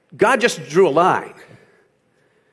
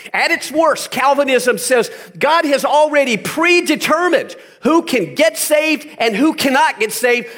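raised, impassioned sermon voice, higher; full-force preaching; 8 semitones higher than usual. Acted. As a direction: preach it hard to the congregation.

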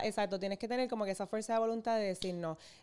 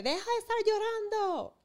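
much higher mock scold; pitched way up like a scolding mom; scolding quote; 12 semitones higher than usual. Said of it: "yo no quiero decirle". (regaño fingido muy agudo)

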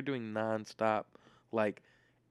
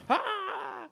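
short, high mock scream; imitated scream. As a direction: imitate her stage scream, pitched way up without full volume. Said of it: "screaming, and then just like".

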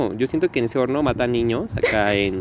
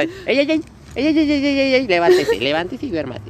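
put-on high, urgent calls to wake a child up; worried grown-up shaking a child awake, calling out; adult waking a child; 14 semitones higher than usual. Acts adult waking sleepy child.

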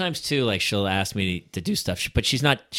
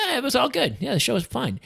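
higher, breezy shrug of a voice; mock laid-back buddy; easygoing friend; 13 semitones higher than usual. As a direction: toss it off like nothing happened. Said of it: "Maz after will be like".

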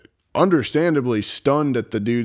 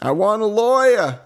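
put-on southern accent; 10 semitones higher, 4 dB louder than usual. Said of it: "I lost my southern accent".

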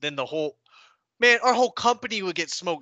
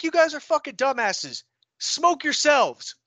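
higher, slow contemptuous order; drill sergeant berating; 9 semitones higher, 5 dB louder, slower than usual. Retold or imitated. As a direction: chew them out like a drill sergeant.

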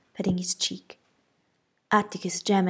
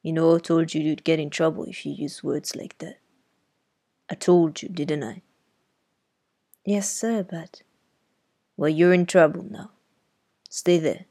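stern uncle; 2 semitones lower, 4 dB louder than usual. A narrator's reading. Uncle reprimanding girl.

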